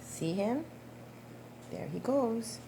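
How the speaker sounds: usual speaking voice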